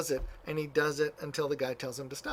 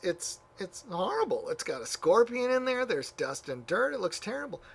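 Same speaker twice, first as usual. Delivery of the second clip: higher, appalled exclamations; disgusted young monk; 7 semitones higher, 4 dB louder than usual. Retold or imitated. Young monk shows the elder the filthy bucket, appalled. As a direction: recoil at the dirty bucket.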